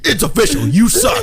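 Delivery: high, loud gleeful insult; hammed-up taunting proclamation; mock announcement. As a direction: declare it like a verdict, climbing high.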